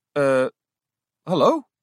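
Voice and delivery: unsure, questioning hello; falter, checking if she was heard; hesitant. A narrator's reading. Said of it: "herhaalde ze aarzelend".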